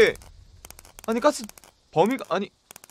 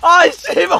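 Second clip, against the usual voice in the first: frustrated outburst; 6 semitones higher, 6 dB louder than usual. Real, not acted. Player dodging attacks, frustrated.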